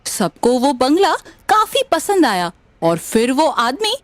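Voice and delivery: ordinary delivery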